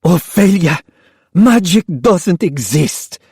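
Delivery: high, slow, bitter voice; mother angrily telling daughter off; exhausted angry mother. Acted. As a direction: crush her hope wearily. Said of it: "hoarse with exhaustion and anger".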